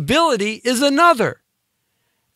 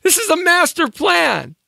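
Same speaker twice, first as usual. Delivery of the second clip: dramatic reveal; 10 semitones higher than usual. Real, not acted.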